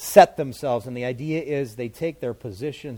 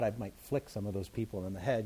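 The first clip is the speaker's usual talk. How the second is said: ordinary talk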